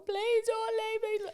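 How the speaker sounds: put-on high, slow, whiny child's plea; imitating pleading little boy. Son begging mum not to leave, high child whine. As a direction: beg like a small boy clinging on.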